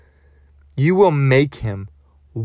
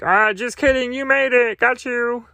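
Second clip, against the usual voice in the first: much higher, gleeful muppet voice; Grover voice; 11 semitones higher than usual. Retold or imitated. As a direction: tease like a giddy puppet. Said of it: "and then Grover's like".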